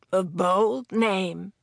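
slow, husky woman's voice. A narrator's reading. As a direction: say it huskily, drawing it out. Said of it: "said Elise huskily".

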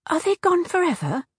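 worried. A narrator's reading. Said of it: "suddenly feeling very worried".

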